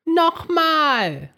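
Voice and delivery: high, loud gleeful cheer; cheering with delight. A narrator's reading. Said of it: "jubelt die Zahnbürste begeistert".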